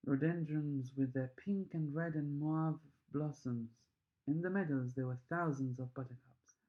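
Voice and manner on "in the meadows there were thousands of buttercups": ordinary delivery, nothing marked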